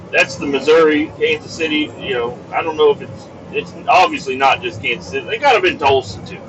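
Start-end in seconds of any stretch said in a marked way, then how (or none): none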